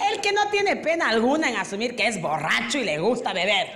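ordinary talk

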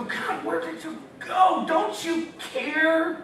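high, slow, drawn-out mock whine. Believer complaining to God in crisis, high mock whine. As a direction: whine it up high like a pleading child.

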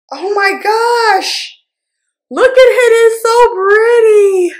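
very high, slow, drawn-out gushing; delighted squeal. Real, not acted. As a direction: coo in awe, building to a squeal. Gushing over a gift shade.

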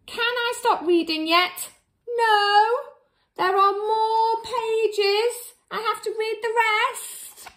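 high whiny complaint; mock whining. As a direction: whine like a trapped reader. Reader whines.

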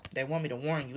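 ordinary talk, nothing marked

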